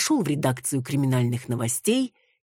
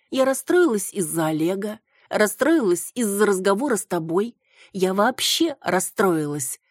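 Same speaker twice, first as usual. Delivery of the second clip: capricious, drawn out and sulky; whiny drawl; 7 semitones higher, 3 dB louder than usual. A narrator's reading. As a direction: whine it like a spoiled child. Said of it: "капризно протянула Антонина".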